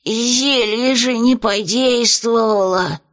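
медленно, сипло, с натугой; выдавить сквозь сжатое горло; натужно просипел. Acted. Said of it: "натужно просипел". (придушенный бес сипит признание)

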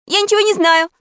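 very high, loud denial; exclaiming. A narrator's reading. Impostor Pashka is accused by Alisa, exclaiming. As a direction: cry out in protest of innocence. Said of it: "воскликнул Пашка".

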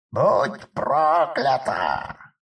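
slow shrieking curse; dying scream. Acted. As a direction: scream your last curse. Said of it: "стало последним криком Евдохи".

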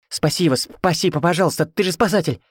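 high, stuttering, frantic pleading; stammering plea. A narrator's reading. Mother-in-law begging the rescuer, high stammering plea. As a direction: stumble over the words while begging. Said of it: "Заикаясь, закричала будущая тёща".

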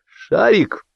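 high sharp call to the dog; shout. A narrator's reading. Man shouting at his snarling dog, high call. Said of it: "крикнул Белявский".

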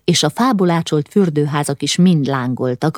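plain reading voice

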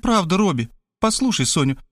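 lightly voiced pleading sister; coaxing. A narrator's reading. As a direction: coax your brother to listen. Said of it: "начала уговаривать меня Кристина".

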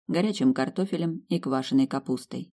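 usual speaking voice

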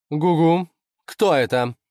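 imperious questioning; demanding. Acted. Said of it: "требовательно спросила лисица".

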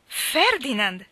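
loud, high cry of the name. A narrator's reading. Mally hearing that Ferdinand is dead, crying out loudly. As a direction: cry out in shocked disbelief. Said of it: "gridò forte Mally".

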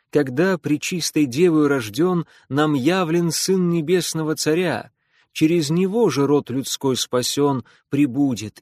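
loud, solemn verse recital; loud declamation. Acted. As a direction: proclaim each line to the whole trench. Russian version of declaimed poem.

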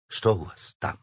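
low, languid official. A narrator's reading. Official asks his staff what is wrong, languid. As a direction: ask it softly, drained of energy. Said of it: "томно спросил Кистунов".

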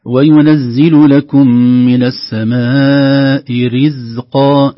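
"رزقا" with the zay fully voiced, very slow and loud; voiced zay demo. Shown as a demonstration. Teacher reciting slowly to highlight the zay. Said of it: "ننتبه لحرف الزاي".

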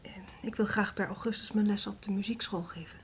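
hushed, hesitant asking; timid request. Acted. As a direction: ask very softly, almost whispering.

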